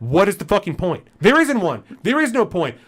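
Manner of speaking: real anger boiling over; furious rant. Real, not acted. Ranting about the store clerk.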